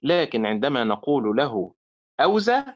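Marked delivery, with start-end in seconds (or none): 2.2-2.6 أوزى said as if the word ends there, rising; wrong stress ending the word. Shown as a demonstration. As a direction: stop short on أوزى with a lifted end. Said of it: "هكذا".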